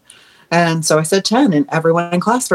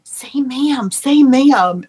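mock yell, higher, growing louder the second time; retold yelling; 7 semitones higher, 3 dB louder, much slower than usual. Classmates pushing the new kid to answer, mock yelling. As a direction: imitate a chanting class, raised pitch. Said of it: "everyone in class starts yelling".

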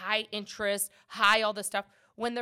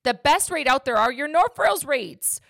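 loud, higher, passionate yell; 5 semitones higher, 9 dB louder than usual. Real, not acted. Broker rants to viewers about North Rails rates, loud yelling. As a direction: raise your voice, fired up about the product. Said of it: "I'm yelling".

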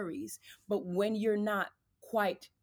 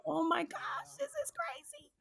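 much higher, squeaky mock dismay; crushed disbelief; 3 dB quieter than usual. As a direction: replay your own stunned reaction, pitched way up and small. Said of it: "I was just like".

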